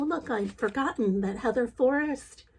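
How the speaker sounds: usual speaking voice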